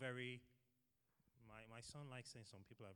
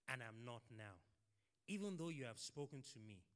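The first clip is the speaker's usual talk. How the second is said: dramatic scripture reading; 4 dB louder than usual. Acted. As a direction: read it as Moses begging the Lord. Reading Moses' plea from scripture.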